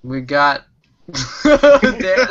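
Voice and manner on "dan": laughing. Real, not acted. Laughing while introducing a guest.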